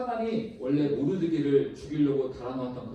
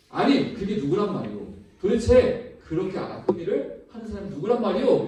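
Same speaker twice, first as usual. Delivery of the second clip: raised, higher voice demanding an answer; king crying out; 5 semitones higher, 5 dB louder than usual. Retold or imitated. King demands of Esther who did it, crying out. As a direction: exclaim as an outraged king in a retelling. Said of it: "왕이 외치는 것입니다".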